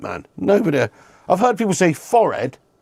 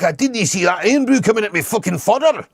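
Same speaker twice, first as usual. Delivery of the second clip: lightly put-on Scottish accent, half joking; mock Scottish accent; 4 semitones higher than usual. Streamer mocking a Scottish chatter's slang, in a light Scottish accent. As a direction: toss off a quick jokey Scottish impression.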